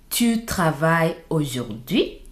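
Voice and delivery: "aujourd'hui" swoops up at the very end; rising question intonation. Shown as a demonstration. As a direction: raise the voice on "aujourd'hui" to ask. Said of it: "raise your voice at the end".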